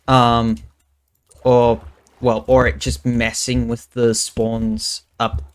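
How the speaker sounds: ordinary talk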